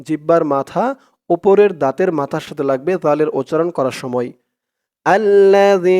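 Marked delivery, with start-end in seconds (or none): 5.0-6.0 আল্লাযী with a soft thin ذ, tongue tip at the upper teeth; আল্লাযী with a light, dental th sound; soft dhal. Shown as a demonstration. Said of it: "জালের উচ্চারণটা একদম নরম পাতলা হবে".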